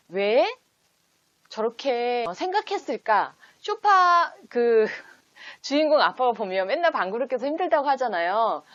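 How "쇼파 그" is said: breaks into a high laugh mid-word. Real, not acted. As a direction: giggle through the word 쇼파. Laughs at the sofa question. (웃음이 터지며 쇼파를 말하듯)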